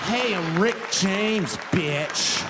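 slow, loud drawn-out catchphrase. Retold or imitated. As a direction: stretch every word out with swagger. [name] repeating his line.

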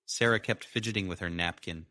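ordinary delivery, nothing marked

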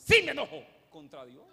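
loud defiant answer; loud angry retort. Acted. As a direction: bark it back at God. Jonás responde airado. (respuesta enojada y fuerte)

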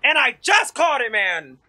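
exasperated rant. Real, not acted. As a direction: hammer the complaint out in frustration. Frustrated rant at dying in game.

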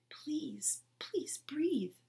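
rising, pleading whisper; whispered plea. A narrator's reading. Toy silently begging the girl to breathe, whispered.

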